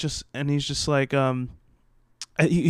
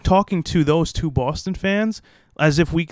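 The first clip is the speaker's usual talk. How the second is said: ordinary delivery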